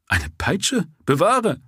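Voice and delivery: a high, incredulous exclamation; mock the very idea; scoffing surprise. A narrator's reading. Constable scoffs at question.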